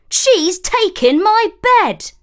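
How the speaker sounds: high, loud indignant dwarf; complaining dwarf. A narrator's reading. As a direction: whine about the stolen bed. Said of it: "asked a third".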